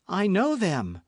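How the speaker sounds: sharp high cry of recognition; cried out. A narrator's reading. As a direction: blurt it out, startled. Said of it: "cried Milady".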